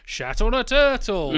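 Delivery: high, loud, drawn-out cockney film quote; Austin Powers. Retold or imitated. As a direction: do a hammed-up comic cockney line. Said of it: "Austin Powers as the perfect example".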